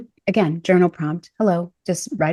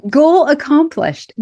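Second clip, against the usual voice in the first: higher, louder and slow, savouring it; triumphant; 8 semitones higher, 6 dB louder, slower than usual. Real, not acted. Declaring her healing goal met, slow loud triumph. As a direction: announce victory like a finish line. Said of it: "Makes me very excited".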